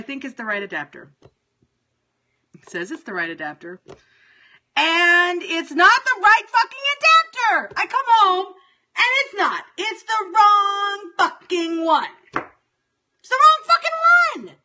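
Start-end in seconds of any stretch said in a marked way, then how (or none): 4.8-9.6 angry rant. Real, not acted. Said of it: "I was in a moment of anger". 9.8-12.1 loud, higher, slow seething complaint; angry. Real, not acted. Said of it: "I was in a moment of anger". 12.3-14.6 much higher, louder, slow shriek of rage; furious shout. Real, not acted. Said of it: "I was in a moment of anger".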